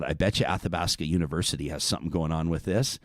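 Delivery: low, soft thinking to oneself; hushed inner thought. Retold or imitated. Host retells a private hunch to listeners, hushed and low. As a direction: drop low and quiet, as if privately scheming.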